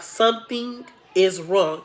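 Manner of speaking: drawn-out, deliberate "Something is wrong"; retold grave verdict, slowly spaced; slow, weighty verdict. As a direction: stretch "Something", then land "is wrong". Doctor's verdict repeated slower for weight.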